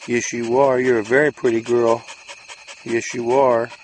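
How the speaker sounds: sing-song praise for the puppy; sweet-talking the dog; doggy talk. Real, not acted. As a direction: coo at the dog like a pet parent. Talking to the dog while brushing.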